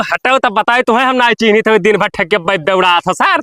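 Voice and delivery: ordinary talk, nothing marked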